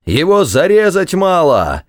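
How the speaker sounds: put-on high-pitched drunken official's holler; shouting. A narrator's reading. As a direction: bellow it in outrage, pitched well up. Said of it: "кричал во всю глотку дьяк".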